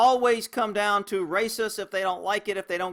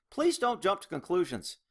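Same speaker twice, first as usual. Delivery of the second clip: ordinary talk, nothing marked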